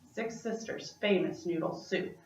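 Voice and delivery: ordinary delivery, nothing marked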